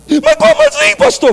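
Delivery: very high, whining question; imitating whining. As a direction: whimper it out high and lost. Sick man's baffled reply. (imitando pergunta chorosa, bem aguda)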